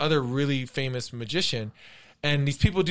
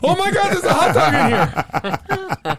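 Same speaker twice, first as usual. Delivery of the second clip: high, loud squeal of mock shock; hammed-up, slow astonished shriek; amazed volunteer; 12 semitones higher, 6 dB louder, slower than usual. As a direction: freak out theatrically while laughing. Playing the magician's shocked volunteer.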